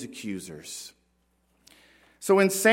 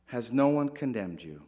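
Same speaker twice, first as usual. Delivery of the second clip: soft tender repeat; 6 semitones lower, 6 dB quieter than usual. Retold or imitated. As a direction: lower and softer, gently repeated. Same question repeated, softer.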